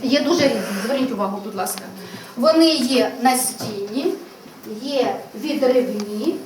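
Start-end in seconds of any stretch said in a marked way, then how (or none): 2.4-6.4 put-on slow, deliberate listing for children; slow teacher naming. Shown as a demonstration. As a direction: spell each type out slowly. Teacher slowly names calendar types. (повільно, чітко, як для дітей)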